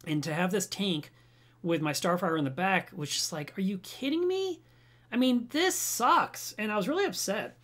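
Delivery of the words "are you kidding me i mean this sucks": high, then slowed-down exasperated complaint; exasperated outburst. Retold or imitated. Owner complaining to himself about wrong glass, exasperated. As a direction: whine it out in disbelief at the glass.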